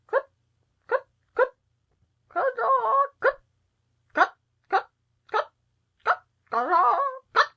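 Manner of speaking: very high, sharp clucking; shrill hen cackle. A narrator's reading. Hen suddenly crying out under the throne, shrill. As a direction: cackle like a startled hen in separate squawks. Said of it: "a shrill voice suddenly cried".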